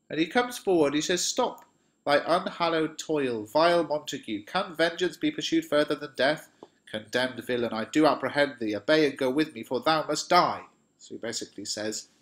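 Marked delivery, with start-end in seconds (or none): 1.2-10.6 loud, high, stern command to halt; commanding challenge. Acted. Paris challenging Romeo at the tomb, commanding and loud. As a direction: order the villain to stop and submit.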